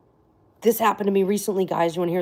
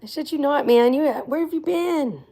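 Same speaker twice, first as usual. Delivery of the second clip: high, eager welcome back; excited greeting; 9 semitones higher, 4 dB louder than usual. Real, not acted. Streamer welcomes McGilla back, excited and high. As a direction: call out happily to a long-lost friend. Greeting the same viewer again.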